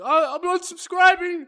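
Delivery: high, shrill mocking whine; whiny commenter. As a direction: squeal it like an offended fan. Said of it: "some asshole in the comments is gonna be like".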